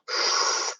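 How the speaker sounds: a long unvoiced breath blown out like cigarette smoke; smoky exhale. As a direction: puff out the smoke before speaking. Imitating old man's smoke exhale.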